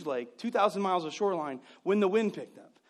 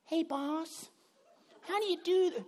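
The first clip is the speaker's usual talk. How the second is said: clueless employee; 9 semitones higher than usual. Retold or imitated. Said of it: "that person that you've taught".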